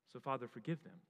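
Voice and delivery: Jesus finishing his prayer, suddenly soft and low; quiet plea. Acted. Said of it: "Jesus is calling to his Father, saying".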